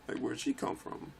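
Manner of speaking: usual speaking voice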